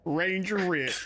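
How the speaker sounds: Ranger Rick voice. Acted. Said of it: "You said it in his voice".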